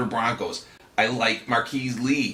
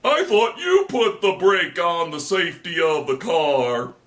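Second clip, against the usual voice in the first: high shrieking puppet; 8 semitones higher, 5 dB louder, slower than usual. Acted. Puppet skit gag.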